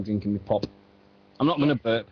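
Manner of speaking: usual speaking voice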